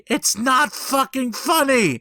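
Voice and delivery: high, slow hurt complaint; whiny protest. Retold or imitated. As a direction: complain like an injured child. Retelling, upset at laughing friend.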